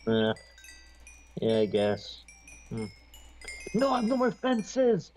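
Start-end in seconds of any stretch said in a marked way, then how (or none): 3.7-5.1 frustrated outburst. Real, not acted. Gamer frustrated at game.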